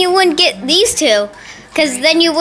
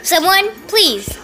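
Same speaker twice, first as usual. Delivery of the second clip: annoyed dog; 5 semitones lower, 4 dB quieter than usual. Pretend. Sadie the dog begging rescue from puppies, mock annoyed. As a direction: voice a fed-up dog, mostly subdued. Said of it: "Sadie's just so annoyed".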